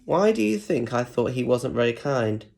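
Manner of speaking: plain reading voice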